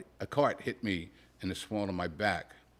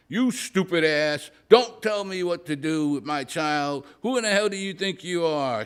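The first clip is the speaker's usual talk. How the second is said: furious shout; 6 semitones higher, 6 dB louder than usual. Acted. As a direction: blast him with rage. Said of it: "she shouted".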